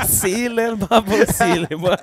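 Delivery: slow, syllable by syllable, laughing; sounding out words. Retold or imitated. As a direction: spell it out like a beginner reader. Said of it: "Pelo sílaba eu percebi".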